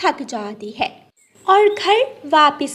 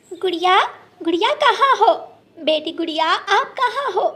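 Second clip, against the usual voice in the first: calling out; 8 semitones higher than usual. Acted. Mother bird calling for her lost daughter, higher worried call. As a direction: call across the forest for your child. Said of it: "mother calling out".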